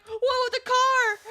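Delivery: high, loud panicked question; freaking out. Retold or imitated. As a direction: imitate a freaking-out mom. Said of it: "she was freaking out".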